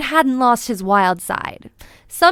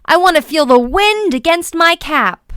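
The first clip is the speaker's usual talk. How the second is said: eager; 6 semitones higher, 5 dB louder than usual. A narrator's reading. Eager acorn cheering.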